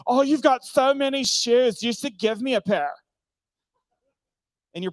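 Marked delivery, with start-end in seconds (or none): 0.1-2.9 much higher, put-on wheedling voice; mock gushing guest. Preacher mimicking a guest asking for shoes, high gushing.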